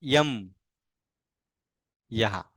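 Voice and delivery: each syllable drawn out alone, long pauses; slow syllable drill. Shown as a demonstration. Teacher showing the ya barakhadi, slow and clear. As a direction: chant each ya syllable slowly for the class. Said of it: "अब य की बारह खड़ी कैसे बनाएंगे?".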